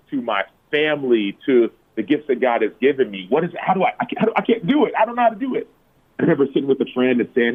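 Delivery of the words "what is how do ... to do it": high-pitched, acted-out helplessness; overwhelmed. Man recounting his struggle to cope, overwhelmed. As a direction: reenact being at a loss, voice climbing high.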